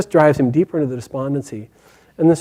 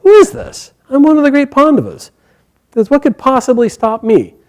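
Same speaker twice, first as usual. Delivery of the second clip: much higher and louder, a proud prince offended; arrogant indignation; 9 semitones higher, 6 dB louder than usual. Acted. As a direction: scoff as one of the great Pandavas. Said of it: "he thinks".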